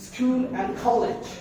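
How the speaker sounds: "school and college" very slow, crisp sk at the start; careful sk sound. Shown as a demonstration. Teacher showing the sk sound in "school" slowly. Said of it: "সিএস sound".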